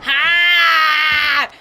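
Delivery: shouted, drawn-out "Hard!" as "haaahd". Shown as a demonstration. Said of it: "say it louder and longer".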